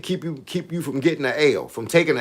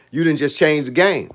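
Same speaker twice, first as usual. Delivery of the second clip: normal talking voice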